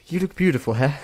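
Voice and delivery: high-pitched mock-British compliment; butchered British accent. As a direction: push through a bad British accent. Bad British accent, second try.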